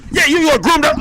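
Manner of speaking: pushy reporter. Retold or imitated. A reporter calling about the ban, a brisk loud reporter voice. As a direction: rattle it off like a cold-calling reporter. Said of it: "the fucking reporter".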